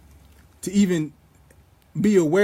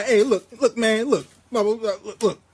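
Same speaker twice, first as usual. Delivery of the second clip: mock complaining; 4 semitones higher, 3 dB louder than usual. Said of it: "bitching the guy".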